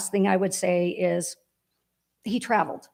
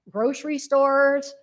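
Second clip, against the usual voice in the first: normal talking voice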